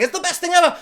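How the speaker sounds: mock-excited fan rave; gushing fan. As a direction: gush like a hyped fan. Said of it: "everyone was like".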